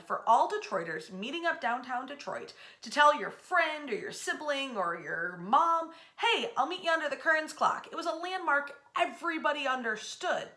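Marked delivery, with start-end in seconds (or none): none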